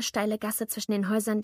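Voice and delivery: ordinary delivery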